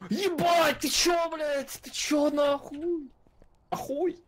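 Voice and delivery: high, loud amazed shouting; excited yelling. Real, not acted. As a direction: yell in wild disbelief. Friend amazed at stripper dance. (восторженный крик)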